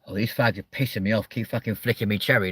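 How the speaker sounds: normal talking voice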